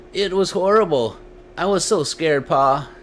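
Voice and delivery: put-on high, trembling child voice; a child near tears from fright; frightened boy. A narrator's reading. Boy recounts fear.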